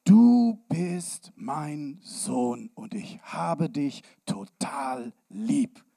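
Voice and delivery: slow, loud solemn voice of heaven. Acted. Heavenly voice tells Jesus he is loved, slow and solemn. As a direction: speak as the heavenly voice, slow and full. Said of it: "Diese Stimme sagt".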